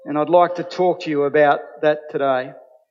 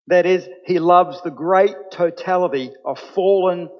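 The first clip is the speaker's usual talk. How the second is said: usual speaking voice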